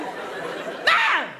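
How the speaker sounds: very high, loud short scream of MUM; panicked call. Shown as a demonstration. Nine-year-old alone calling mum, panicked shriek. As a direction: mock-desperate shriek for mother. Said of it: "a whole new level of panic".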